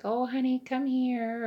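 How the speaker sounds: high, loud doting granny voice; imitating the elderly resident, high and tender; sweet old lady voice. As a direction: coo like a tiny elderly lady calling a child. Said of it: "And she's like".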